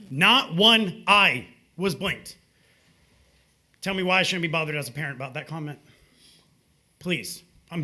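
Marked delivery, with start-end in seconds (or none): 0.1-2.4 each word spaced out slowly and louder; slow emphatic. Real, not acted. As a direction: drive it home word by word. Indignant speaker at public podium.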